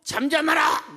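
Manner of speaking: high shouted command; scolding shout. Retold or imitated. Crowd rebuking the blind man, acted sharp shout. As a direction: imitate a crowd yelling for silence. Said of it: "꾸짖었어요".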